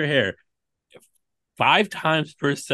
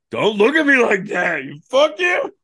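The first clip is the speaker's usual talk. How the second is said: mock yell; 8 semitones higher, 5 dB louder than usual. Retold inner outburst.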